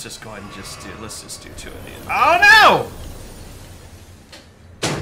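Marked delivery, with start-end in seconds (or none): 2.1-4.9 high loud oh no; alarmed cry. Real, not acted. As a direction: yelp it in alarm. Game death cry.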